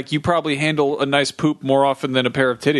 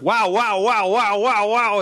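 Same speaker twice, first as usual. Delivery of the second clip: leering wow; 6 semitones higher, slower than usual. Retold or imitated. Leering at wife changing.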